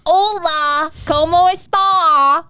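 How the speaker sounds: high, drawn-out mock Spanish; exaggerated tourist Spanish; mock Spanish greeting. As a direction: greet him in put-on Spanish, stretched slowly.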